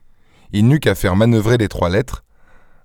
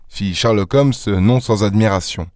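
ordinary delivery

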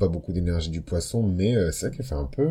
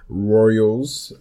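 loud, higher English-accented "[name]"; accent anglais appuyé; 4 semitones higher, 7 dB louder than usual. Shown as a demonstration. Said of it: "désolé pour mon accent anglais". (accent anglais forcé, plus fort)

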